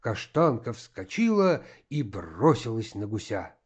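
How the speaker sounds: usual speaking voice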